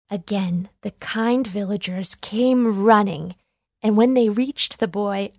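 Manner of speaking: plain reading voice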